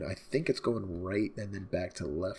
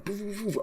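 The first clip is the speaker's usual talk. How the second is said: high sing-song boo sounds; mock sound effect; 12 semitones higher, faster than usual. Viewer mimicking the anime's sound cue, high and playful.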